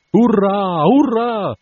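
high, loud hurrah; long, high-pitched cheer; cheering shout. A narrator's reading. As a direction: cheer like a crew of sailors. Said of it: "gridarono i marinai".

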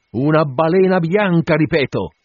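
loud, high proclamation; captain's ringing cry; emphatic repeat. A narrator's reading. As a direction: drive it home at the top of the voice. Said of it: "tornò a dire Acab".

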